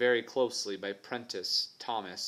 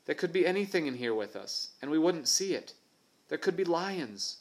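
higher, fearful schoolboy voice; nervous boy voice; 6 semitones higher than usual. Acted. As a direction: scare yourself as a boy. Tubby Ted frightened in jungle.

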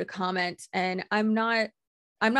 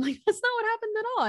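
fast, much higher, laughing disbelief; faster than usual. Real, not acted. Brushing off the accuser's claims, laughing fast.

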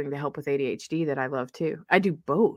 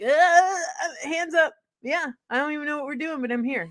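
higher, fast, breathless hands-up voice; eager hand-raiser; 11 semitones higher than usual. Retold or imitated. ADHD student eagerly raising hand, high and rushed. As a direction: blurt it out, over-eager. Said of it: "driven to be like".

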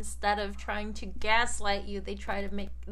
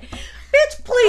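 high, loud scoffing dismissal; sassy scoff; dismissive sass; 13 semitones higher, 9 dB louder than usual. Real, not acted. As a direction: wave it off with sass. Dismissing retold line.

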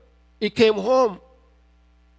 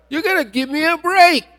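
higher-pitched mild protest; exasperated plea; 6 semitones higher, 3 dB louder than usual. Retold or imitated. Grieving parent answering God back, exasperated plea. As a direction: plead for some relief, voice lifting.

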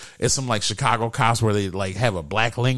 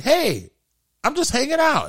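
high, sweet innocent reply; lightly mocked innocent tone; 11 semitones higher than usual. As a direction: play it harmlessly innocent, way up high.

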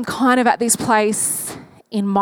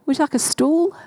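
ordinary delivery

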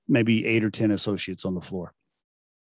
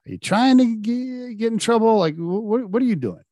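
high-pitched, stammering disbelief; incredulous; 11 semitones higher than usual. Retold or imitated. Manager silently questioning a smart-mouthed kid, incredulous. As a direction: stretch the first question out, voice climbing in mock bafflement. Acted baffled inner challenge to kid.

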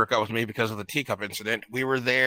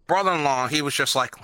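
ordinary delivery

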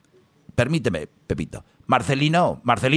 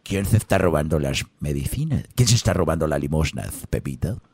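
priest voice; 8 semitones lower, 3 dB quieter, faster than usual. Acted. Priest questions Pepito, lower voice.

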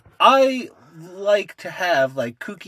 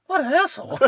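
mother's voice; 13 semitones higher than usual. Retold or imitated. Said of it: "Even she was like".

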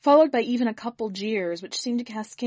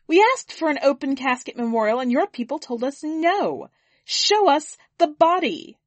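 higher, louder furious demand; angry demand; 7 semitones higher, 7 dB louder than usual. Acted. Grieving woman demands the casket opened, furious shout. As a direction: demand to see the body.

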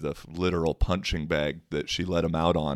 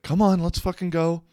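high-pitched urging; exasperated friend imitated; 11 semitones higher than usual. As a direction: mimic a friend dragging her away. Retold friend pulling her inside.

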